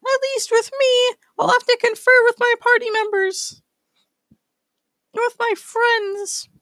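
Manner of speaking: very high squeaky critter voice; Space Squirrel. Acted. Squirrel accepting the townsman's offer, very high squeaky voice. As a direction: squeak it out like a tiny cartoon animal. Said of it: "and Space Squirrel goes".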